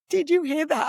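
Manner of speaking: high, amazed retold exclamation; excited freak-out. To her sister after daughter's praise, excited freak-out. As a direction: blurt it high to a confidant, thrilled. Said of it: "I was, like, freaking out".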